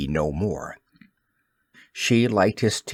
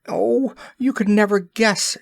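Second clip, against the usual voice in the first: high fairy voice, much higher, bursting with news; excited fairy cry; 10 semitones higher than usual. A narrator's reading. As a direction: tease him with the news, way up high. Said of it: "she cried".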